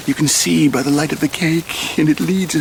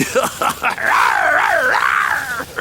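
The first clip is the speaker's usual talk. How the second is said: high, gloating villain cackle; evil laugh; 7 semitones higher than usual. Acted. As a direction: throw back a big wicked laugh. Villain gloats over poisoning.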